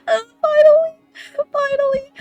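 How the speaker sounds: high, loud, slow relieved cry; longing for the kiss, high and loud relief; relieved squeal. Retold or imitated. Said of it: "I was like".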